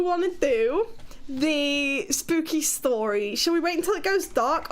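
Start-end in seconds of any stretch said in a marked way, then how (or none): none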